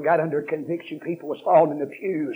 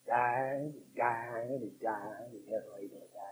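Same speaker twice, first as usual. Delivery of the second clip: fading hush; 4 semitones lower, 11 dB quieter than usual. Acted.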